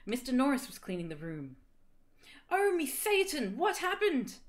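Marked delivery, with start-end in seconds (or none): 2.5-4.4 high, loud dramatic cry; hammed-up scream of horror. A narrator's reading. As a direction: cry it out, pitched way up. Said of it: "I screamed".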